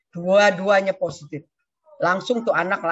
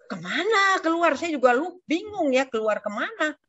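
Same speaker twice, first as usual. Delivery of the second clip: pitched up high in disbelief; high, baffled protest; bewildered; 8 semitones higher than usual. Real, not acted. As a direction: ask it in baffled exasperation. Bewildered reaction to doctor's order.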